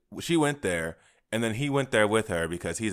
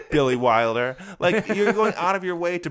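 higher, slow scolding through laughter; laughing exasperation; 5 semitones higher, 4 dB louder than usual. Real, not acted. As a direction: scold the director, laughing as you go. Laughing while scolding the director.

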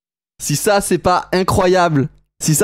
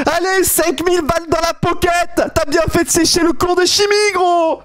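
high, excited yelling; cris de joie; 7 semitones higher than usual. Real, not acted. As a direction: holler congratulations at a friend. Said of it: "je suis en train de gueuler". (gueuler de joie, voix aiguë)

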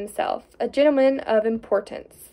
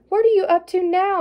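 wife's voice; 7 semitones higher, 4 dB louder than usual. A narrator's reading. Wife voiced in the story.

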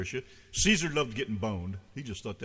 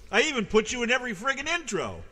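mock indignant; 12 semitones higher, 5 dB louder than usual. Mock complaining to absent friend.

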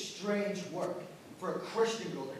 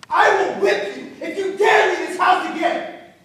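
much higher, much louder threatening yell with a laugh; furious shout; 12 semitones higher, 18 dB louder than usual. Acted. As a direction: roar the threat at her. Master threatens servant girl.

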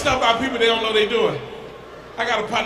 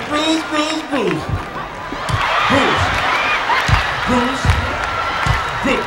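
woman calling him; 4 semitones higher, much slower than usual. Retold or imitated. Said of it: "She be like".

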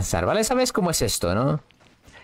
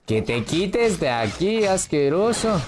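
angry yell; 7 semitones higher, slower than usual. Real, not acted. Gamer yelling at an enemy to move, angry shout. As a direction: drive the disgusting thing away.

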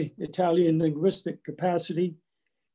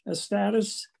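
normal talking voice

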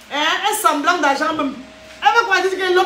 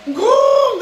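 laughing shout; 7 semitones higher, 5 dB louder than usual. Real, not acted. Laughing exclamation.

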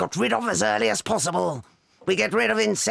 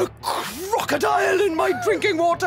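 outraged shout; 10 semitones higher than usual. Acted. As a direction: roar in outrage. Accusing pupil of crocodile trick.

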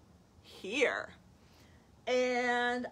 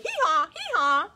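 high, loud hee-haw; donkey bray imitation; 9 semitones higher, 10 dB louder than usual. Showing children a zebra's bray, loud hee-haw. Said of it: "kinda like".

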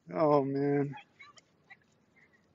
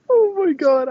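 high, loud squeal through laughter; real giggly shock; startled laughter; 13 semitones higher, 5 dB louder than usual. Real, not acted. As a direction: gasp it out laughing after a scare. Laughing after being startled by skateboard.

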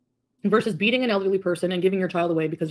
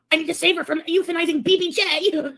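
mock rival voice; 9 semitones higher than usual. Mocking rival's claim.

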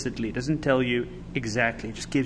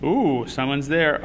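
plain reading voice

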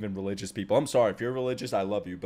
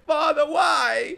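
high, slow wailing plea; mock anguished cry; 4 dB louder, much slower than usual. Voicing the dog crying to the father, slow high wail. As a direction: stretch it out like a wounded howl. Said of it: "Dog's like".

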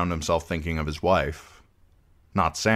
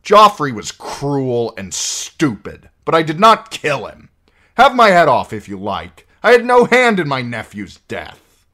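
loud, raised protest; dwarf shouting his innocence at the trial; angry outburst; 9 semitones higher, 11 dB louder than usual. A narrator's reading. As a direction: lose his temper before the court. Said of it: "Tyrion's anger overwhelmed his sense".

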